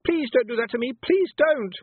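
high, loud scared cry; terrified shout. Acted. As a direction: shriek at her to stop. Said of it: "she shouted".

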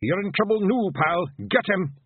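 high-pitched threatening call; Rob Anybody. A narrator's reading. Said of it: "Rob Anybody's voice echoed among the trees".

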